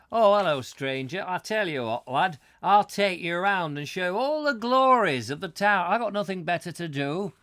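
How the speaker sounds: loud, high, hammed-up northern English local; exaggerated northern English welcome; northern English accent. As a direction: gush like an over-friendly northerner. Mock friendly northern local acted out.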